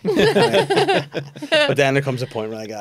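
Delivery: normal talking voice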